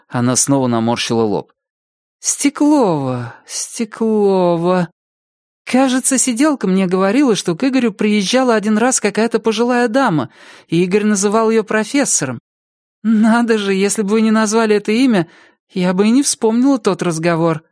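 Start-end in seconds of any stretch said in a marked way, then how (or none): 2.2-4.9 slow, drawn-out pondering; musing recall. A narrator's reading. Ex-wife trying to place a surname, slow musing. 5.7-17.6 higher woman's voice, remembering; lightly voiced recollecting ex-wife. Ex-wife recalls an elderly visitor for police, lightly higher voice.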